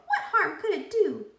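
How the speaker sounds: high pigtail voice with a laugh; coaxing pigtail. A narrator's reading. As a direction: tempt him lightly, laughing.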